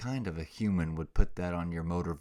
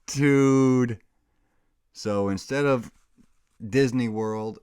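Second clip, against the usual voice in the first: loud; 6 semitones higher, 10 dB louder, slower than usual. Real, not acted. Loud complaint after laughing.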